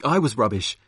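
ordinary talk

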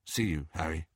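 low, soft, mumbled goodbye; muttering. A narrator's reading. Dudley saying an awkward goodbye to his cousin, muttering. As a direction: mumble the parting low and quietly. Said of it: "muttered Dudley".

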